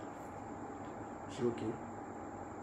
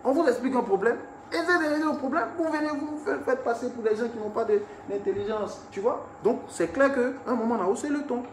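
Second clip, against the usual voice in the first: much higher, exasperated complaint; irritated, raised tone; 7 semitones higher than usual. Retold or imitated. Telling the police off at the airport, exasperated and high. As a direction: replay the annoyance at the police. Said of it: "j'ai haussé le ton parce qu'à un moment donné, c'était énervant".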